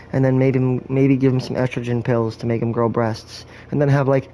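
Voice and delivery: usual speaking voice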